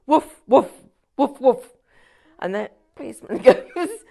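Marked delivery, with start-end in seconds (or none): 0.1-1.7 mock dog woofing, louder; dog barks. Englishman hiding in a sack, mock dog barking. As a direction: bark like a puppy in a sack. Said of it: "the Englishman goes".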